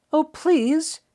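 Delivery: put-on high, begging boy's please; pleading. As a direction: plead with him, sweet and hopeful. Boy pleads.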